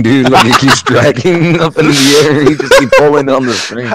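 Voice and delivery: laughing. Real, not acted. Mocking friend's bouncing character.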